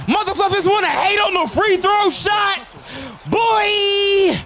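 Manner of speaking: loud, high trash-talk yell; hype taunt shout. Real, not acted. Gamer taunting haters after clutch free throws.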